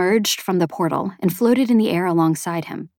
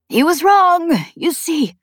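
hoarse, higher-pitched and upset; 10 semitones higher, 4 dB louder, slower than usual. A narrator's reading. Chet weeps to Spensa over the vision, hoarse. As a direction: push it out hoarsely through tears. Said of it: "his voice hoarse".

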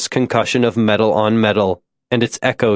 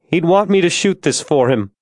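higher, tearful narrated voice; crying; 6 semitones higher than usual. A narrator's reading. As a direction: voice lifted as if close to tears. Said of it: "she was crying again".

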